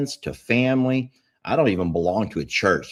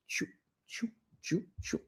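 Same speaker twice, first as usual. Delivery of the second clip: soft, high choo-choo of a departing train; train noise; 9 semitones higher, 14 dB quieter than usual. Retold or imitated. Host shows friends vanishing, soft train sound. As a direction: puff it away like a toy train.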